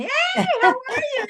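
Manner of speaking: high greeting called from a distance; calling out. Retold or imitated. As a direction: call out across the store, high. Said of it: "I shout from the far away".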